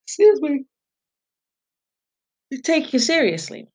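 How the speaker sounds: talking through a yawn. Real, not acted. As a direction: stretch the words out mid-yawn. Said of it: "You see that effect of me being yawning".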